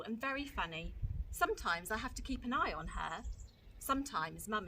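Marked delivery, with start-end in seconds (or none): none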